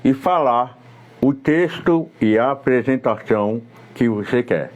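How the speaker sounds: words around a pen held across the mouth; pen in teeth. Shown as a demonstration. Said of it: "colocar ela transversal".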